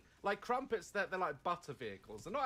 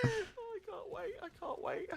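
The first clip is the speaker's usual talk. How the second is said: giddy; 14 semitones higher than usual. Real, not acted. Giddy at next video.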